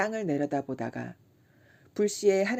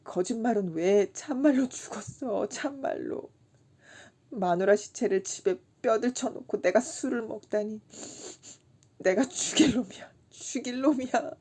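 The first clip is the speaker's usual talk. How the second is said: a touch of tearful wailing; mildly weeping self-blame; crying; 6 semitones higher than usual. A narrator's reading. Kim weeping to Chisam about his dead wife, mildly tearful. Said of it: "엉엉 소리를 내어 운다".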